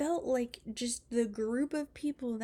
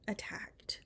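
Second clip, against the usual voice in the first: whispered word; 9 dB quieter than usual. Real, not acted. Dropped word at sentence end.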